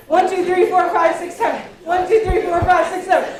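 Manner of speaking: energetic group count-down chant; loud counting. Real, not acted. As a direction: shout the count loud and high, fast and rhythmic. Group shake-down counting game.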